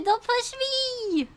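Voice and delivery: high loud playful shout; hammed-up cry of protest; mock panicked yell. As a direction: pretend to be shoved in the sea. Pretend shoved into ocean.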